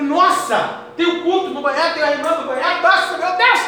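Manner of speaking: high-pitched, rising startled exclamation; alarmed realization. Retold or imitated. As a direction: act out suddenly remembering with alarm. Relembra susto por esquecer o culto. (susto de quem se lembrou do compromisso)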